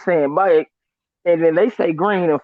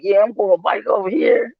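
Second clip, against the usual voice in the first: Florida drawl; 2 semitones higher than usual. Retold or imitated. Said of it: "I be like".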